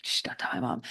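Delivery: whispered playful aside; hushed secret. Pretend. Podcaster sharing a secret kept from mom, whispered. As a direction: shush them and whisper it. Mock secret confided to listeners.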